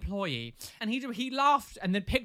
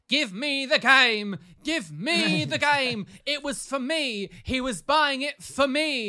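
high, loud, slow hammed-up yell; drawn-out mock demand; mock shouting woman; 7 semitones higher, 7 dB louder, much slower than usual. Angry mum at the till, hammed-up yell. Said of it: "she was like".

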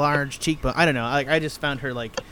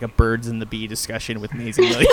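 normal talking voice

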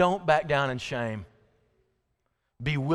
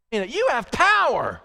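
high, loud, slow declaration; rallying shout, drawn out on power; emphatic demonstration; 9 semitones higher, 5 dB louder, slower than usual. Shown as a demonstration. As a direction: belt it out with full conviction. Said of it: "I want you to say it like".

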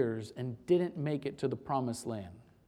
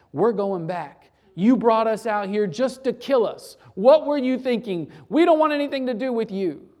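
loud, rising angry complaint, faster at the end; angry threats; 4 semitones higher, 8 dB louder than usual. Acted. Grumbling Israelites shouting threats at Moses, angrily.

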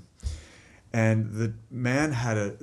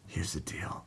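low half-whisper, acting the wise sister; confidential hush; 4 semitones lower, 10 dB quieter than usual. Sister to siblings once the servers have left, hushed and confiding. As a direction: let the kids in on a secret plan. Sister's secret plan after adults leave.

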